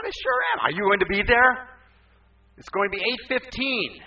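loud, high-pitched insistence; boisterous retold challenge; acted emphatic retort. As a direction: fire it back with full conviction. Acts his emphatic answer to mother.

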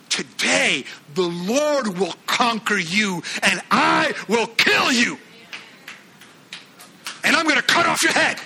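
slow then rising into a shout; David's defiant cry. Acted. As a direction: bellow it like a battle cry. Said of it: "He says".

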